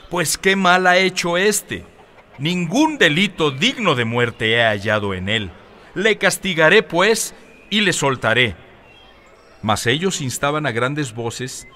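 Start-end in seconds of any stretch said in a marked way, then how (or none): 0.1-8.6 pleading shout. A narrator's reading. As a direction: raise the voice to reason with a mob. Pilate pleading over shouting crowd.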